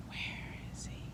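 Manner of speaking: soft unvoiced whisper, hushed and secret. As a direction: breathe the question as if searching quietly. Child's picture book, searching underwater.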